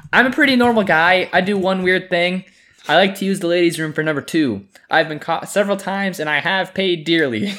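high, loud Creed confessional voice; reality tv confessional. Retold or imitated. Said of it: "it cuts to him in a confessional".

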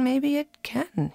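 slow, thoughtful concession; slow musing maybe; said slowly. Retold or imitated. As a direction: draw it out, pondering. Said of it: "he says slowly".

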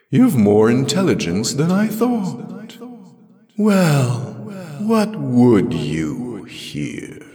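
high, loud, drawn-out echoing speech; otherworldly voice. A narrator's reading. The voice asks what Jirel would here, ringing and otherworldly. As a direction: challenge her in a ringing, swooping voice.

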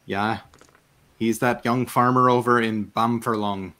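rural Marish fisherman, halting at first; Marish accent. Acted. Fisherman telling travellers about a local farmer, Marish accent. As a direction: think it over in a Marish accent. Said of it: "talking in this accent because it's amazing".

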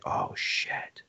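whispered retold curse; hushed dismay. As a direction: let it out under your breath, dreading. Retold reaction to the ominous warning.

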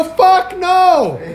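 very high, loud shout; shouted, pitch shooting way up; outraged refusal. Retold or imitated. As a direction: explode in a comic retold refusal. Retold quarrel, refusing more delay.

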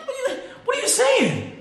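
very high-pitched, disbelieving laughing cry; incredulous outburst. Real, not acted. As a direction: squeal it in amused disbelief. Incredulous reaction to designer-belt joke.

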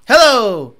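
normal talking voice

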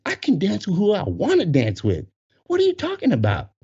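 high, rising outrage; acted indignant protest. Dancer defying the employee, high-pitched indignation. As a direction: argue back in mock outrage, pitch climbing.

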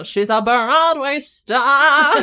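loud, high, drawn-out Broadway flourish; mock showbiz proclamation, stretched long; showy Broadway belt. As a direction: belt it out like a hammed-up stage star. Mock showbiz answer about her music.

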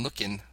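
LOOKIN' ending in plain n; shortened ending. Shown as a demonstration. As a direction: drop the g from "lookin'". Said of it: "sometimes it is shortened to in".

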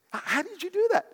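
high, astonished squeal of a question; amazed question. Retold or imitated. Preacher playing his amazed self, asking a rich man how. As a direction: gasp it out in wonder, way up high.

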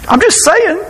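mock excuse. Mocking the excuse phrase.

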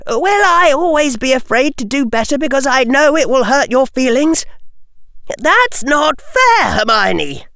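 high-pitched, pleading character voice; upset friend protesting. Acted. Friend pleading with Hermione not to be unfair, high, upset protest. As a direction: protest up high, drawing out the last complaint.

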